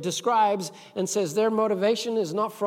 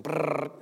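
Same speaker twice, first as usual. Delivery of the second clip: rapid rolled brrr; imitated paintball gun; 4 semitones lower, 5 dB quieter than usual. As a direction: mimic rapid gunfire with a rolled trill.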